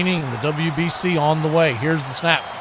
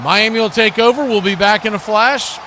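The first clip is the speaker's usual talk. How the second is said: radio break announcement; 6 semitones higher, 3 dB louder than usual. Real, not acted. Going to commercial break.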